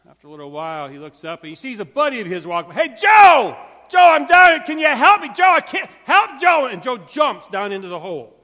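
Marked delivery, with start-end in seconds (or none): 2.7-6.7 loud high desperate shouting; shouting for a friend. Acted. Man in a hole yells up to his friend Joe, frantic shouting. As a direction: yell for your buddy to save you.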